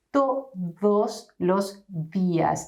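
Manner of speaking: todos with the tongue tip touching the teeth; Spanish dental d. Shown as a demonstration. Teacher modelling todos los días for learners. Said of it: "bite your tongue and say".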